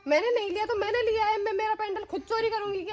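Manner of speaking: high-pitched, scornful rhetorical question; indignant retort. Acted. Employer mocking maid's denial, indignant. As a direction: throw her denial back at her.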